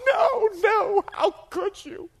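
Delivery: high, loud mock wail; hammed-up sobbing shout; crying. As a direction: imitate hysterical tearful protest.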